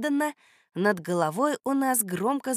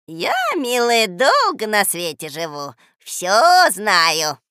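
slow, loud, swooping croon; sugary old woman; 9 dB louder, slower than usual. A narrator's reading. Old woman explaining herself to the girl, crooning. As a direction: speak knowingly, drawing out the vowels.

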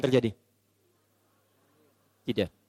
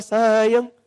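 sweet call; 4 semitones higher, 3 dB louder than usual. Acted. Husband sweetly calls his wife.

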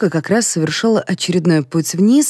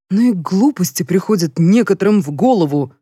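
plain reading voice

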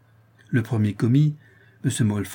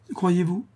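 "Croyez-vous" as a higher-pitched question; 6 semitones higher than usual. A narrator's reading. Daughter doubts the captain's word on her visits, questioning. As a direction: lift "Croyez-vous" high, openly questioning. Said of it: "reprit Florence d'un ton interrogatif".

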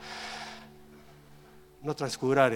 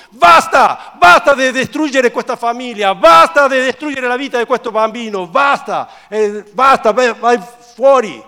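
high, full-force shouting; relentless loud exorcism cry; shouted rebuke; 11 semitones higher, 19 dB louder than usual. Acted. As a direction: drive the spirit out with furious commands. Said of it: "e sgridò allo spirito immondo".

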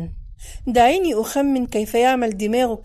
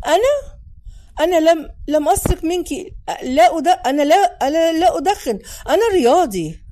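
high, loud, stammering protest; flustered denial; 6 semitones higher, 4 dB louder than usual. Acted. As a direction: protest innocence, tripping over the words. Boy denies being caught stealing cigarettes.

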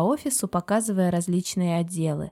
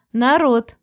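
raised, louder and higher attention-getting call; loud announcement; 5 semitones higher, 8 dB louder than usual. A narrator's reading. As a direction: call out loudly to the whole room. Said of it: "громко объявила тётя Вера".

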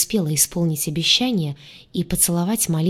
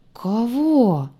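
higher-pitched, drawn-out suspicion; suspicious drawl; 4 semitones higher than usual. A narrator's reading. Green one questions the redhead, suspicious drawl. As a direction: stretch the word out warily. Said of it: "подозрительно протянул зелёный".

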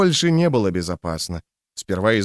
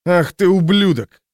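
angry outburst; 8 semitones higher, 5 dB louder than usual. A narrator's reading. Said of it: "сказал Фреймер".

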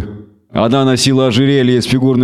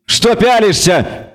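higher, slow hissing sneer; hissed; 9 semitones higher, slower than usual. A narrator's reading. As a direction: drag it out with venom. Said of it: "прошипела эльфка".

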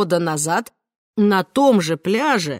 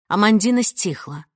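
plain reading voice

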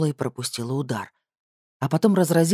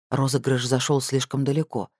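usual speaking voice

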